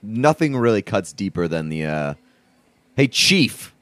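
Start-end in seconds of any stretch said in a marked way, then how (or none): 3.0-3.7 higher, smug bro taunt; mock bro voice. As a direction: sneer it like a meathead. Mocking a bro's taunt.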